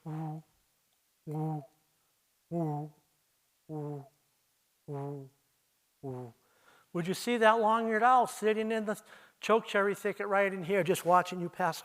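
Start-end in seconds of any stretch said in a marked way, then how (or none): 0.1-6.3 quiet, low single hoots; soft low owl hoots. Shown as a demonstration. Imitating a long-eared owl in a thicket, soft low hoots. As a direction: hoot softly and low, spaced out. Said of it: "and you hear".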